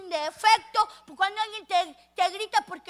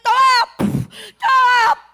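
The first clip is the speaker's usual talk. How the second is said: shouted, punched words; loud blows; 6 semitones higher, 13 dB louder, slower than usual. Acted. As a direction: yell as you strike. Thugs beating Lucas.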